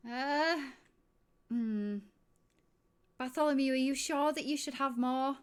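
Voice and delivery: high, loud, wary barmaid; doubtful barmaid. Acted. Barmaid warns a drunk guard, high and loud. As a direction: she frets over the drunk guard. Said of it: "looks at the guard and is like".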